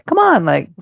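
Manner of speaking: high coaxing sing-song; coaxing fairy voice. Acted. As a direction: coax the animals like a fairy. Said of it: "performance I'm putting on".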